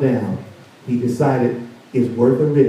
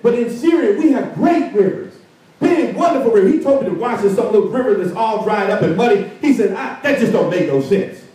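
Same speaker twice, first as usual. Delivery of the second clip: scornful general; 7 semitones higher than usual. Acted. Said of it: "though he was angry".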